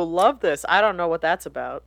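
ordinary delivery

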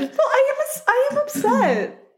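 choked up but laughing at herself; teary and upset. Real, not acted. Admitting to her co-host she is teary over Lydia's story, voice going high. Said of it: "You look teary-eyed".

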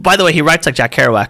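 ordinary talk, nothing marked

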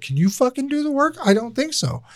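high, sharp mock snap, imitating himself; snapping at wife. Husband reenacting how he snapped at his wife, high sharp mock snap. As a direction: fire it back like a stressed husband losing it. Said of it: "I was like".

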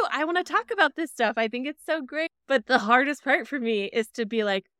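ordinary talk

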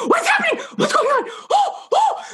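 very high, panicked mock shriek through laughter; terrified husband. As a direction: squeal the husband's startled panic, half laughing. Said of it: "that look of terror in his eyes".

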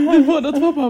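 high, loud laugh-cry; laughing and crying over the surprise; laughing through tears. Real, not acted. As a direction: laugh while close to tears.

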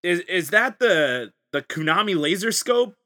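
high, put-on casual surprise; hammed-up surprise. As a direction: act like you just noticed it. Acted basement scenario, fake surprise.

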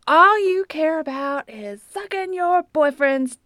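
high, slow mock teenage outburst; strained, drawn-out imitation of an angry teen; retold outburst. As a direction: drag it out high, imitating an aggrieved son.

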